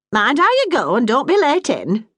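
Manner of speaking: a hint of a brisk northern mum; Bessie, motherly Yorkshire woman. Bessie warns her son.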